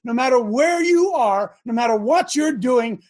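ordinary delivery

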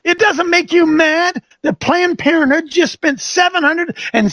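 high, insistent preacher demanding answers; fiery preaching. Acted. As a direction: press the crowd like a fired-up preacher. Preaching at full force.

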